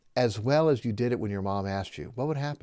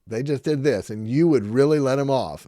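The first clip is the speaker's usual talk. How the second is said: mock surprise; 4 semitones higher, 10 dB louder than usual. Father challenging son's answer.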